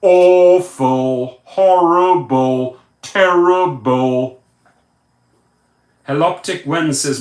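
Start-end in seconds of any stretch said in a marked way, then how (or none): 0.0-4.4 "awful, horrible, terrible" each stretched out slowly and loudly; slow, loud first syllables on "awful, horrible, terrible"; heavy emphasis. Shown as a demonstration. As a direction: hammer "awful, horrible, terrible" one drawn-out word at a time. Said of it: "Emphasis on".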